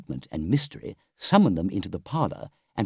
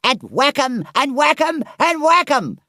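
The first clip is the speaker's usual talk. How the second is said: very high, loud gleeful shrieks; ecstatic Toad; 7 dB louder, slower than usual. A narrator's reading. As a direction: chant it wildly, beside yourself with glee. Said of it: "cried the Toad in ecstasy".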